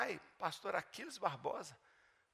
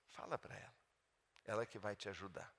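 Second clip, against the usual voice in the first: hushed voice of God; 7 semitones lower, 8 dB quieter than usual. Retold or imitated. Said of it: "Na hora Deus falou comigo".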